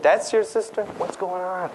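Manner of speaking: high, incredulous questioning; baffled playmates. Acted. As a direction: mimic confused kids piecing it together. Acted astonished playmates reacting.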